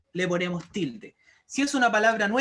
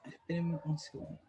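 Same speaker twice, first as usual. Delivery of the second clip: much softer, off-hand aside; low aside; 5 semitones lower, 11 dB quieter than usual. Real, not acted. Teacher asking the class to wait over a small problem, low aside. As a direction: drop the volume right down, briefly stepping out of the lesson.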